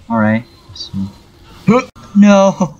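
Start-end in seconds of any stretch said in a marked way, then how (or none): 1.7-2.8 sudden gasp then a high no. Real, not acted. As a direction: gasp and cry out no. Sudden danger in game.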